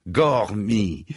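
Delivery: a lazy, drawn-out mocking greeting; drawling. A narrator's reading. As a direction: stretch the name out, teasing and unhurried. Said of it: "sur un ton traînant".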